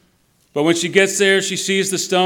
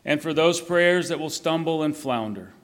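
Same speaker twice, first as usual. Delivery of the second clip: prayer; 3 semitones lower than usual. Acted. Reads out a written Easter prayer.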